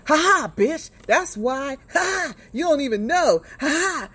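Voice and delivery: high, mocking ha ha; gloating taunt. Man gloats at his girlfriend, high mocking taunt. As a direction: taunt her like you've won. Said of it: "he's like".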